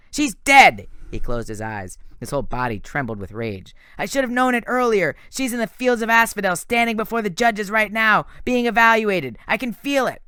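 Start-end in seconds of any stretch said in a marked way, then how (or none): 0.1-0.9 high, loud, cutting him off; grief-stricken shout. A narrator's reading. Nico shouts back in grief. 4.0-10.2 high, loud, trembling with anger; a boy sure his sister is being judged; raging grief. A narrator's reading.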